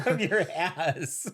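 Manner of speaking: giggling through the words; laughing. Real, not acted. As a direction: laugh it out slowly. Teasing about butt pictures.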